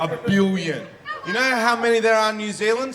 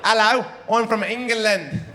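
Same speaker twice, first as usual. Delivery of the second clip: exaggerated mock English accent; 2 semitones higher than usual. Shown as a demonstration. Comic comparing accents for the crowd, mock English accent. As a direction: put on a bad English accent. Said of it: "exact same thing in three, four accents".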